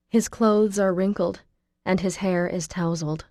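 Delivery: usual speaking voice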